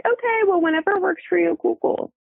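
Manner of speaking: high, breezy, over-accommodating; overly easygoing. Retold or imitated. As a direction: play the pushover, all smiles. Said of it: "my point of relativity is".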